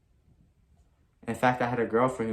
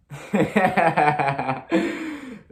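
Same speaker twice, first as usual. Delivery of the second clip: loud laugh; 9 semitones higher, 8 dB louder than usual. Real, not acted. Laughing after the chant.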